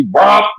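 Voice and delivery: high little mock fart pop; imitated fart pop. Retelling her letting it out, high mock pop. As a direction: pop a short cartoon fart sound.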